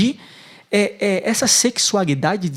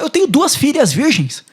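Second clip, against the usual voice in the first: pleading offer; 8 semitones higher, 5 dB louder than usual. Retold or imitated. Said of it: "ele fala".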